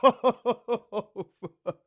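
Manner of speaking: words breaking up in real laughter; helpless laugh through the words. Real, not acted. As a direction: crack up while swearing. Cracks up after his rant.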